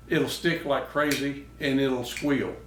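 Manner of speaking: usual speaking voice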